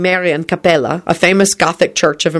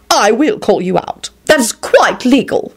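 adamant; 5 semitones higher than usual. A narrator's reading. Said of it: "he stated adamantly".

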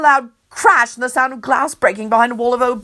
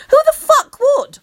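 outraged; 7 dB louder than usual. A narrator's reading. Narrator's outraged aside.

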